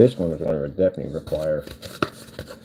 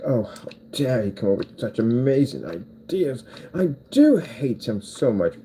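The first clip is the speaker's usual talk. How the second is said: mock indignant London accent; slow, put-on London English with drawn-out words; 4 semitones higher, slower than usual. Haters grumbling about the speaker's great ideas, mock London accent. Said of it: "people from London, I love your accent".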